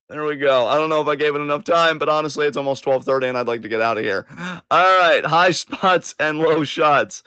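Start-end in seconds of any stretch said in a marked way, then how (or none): none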